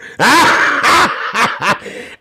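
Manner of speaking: high cackling laugh. Real, not acted. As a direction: cackle at your own jibe. Laughs at own jibe.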